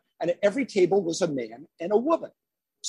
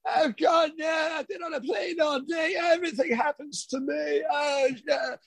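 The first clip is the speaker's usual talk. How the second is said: whiny Birmingham moan, higher, put-upon; 8 semitones higher than usual. Retold or imitated. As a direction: moan like a jet-lagged rock star, Birmingham accent. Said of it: "And then [name] is going".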